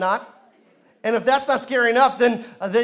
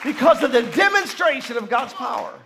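higher, louder shouted preaching; preaching at full force; 6 semitones higher, 7 dB louder than usual. Acted. Pastor stirs the congregation, shouted preaching. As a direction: build to a roaring climax.

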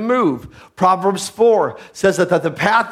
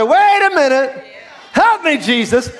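high, louder plea to Jesus; mock crying out; 7 semitones higher, 4 dB louder than usual. Believer just below the new standard, mock crying out. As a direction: call out for help in mock alarm.

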